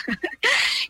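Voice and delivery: a burst of high laughter. Real, not acted. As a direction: laugh out at the memory. Laughing at her own story.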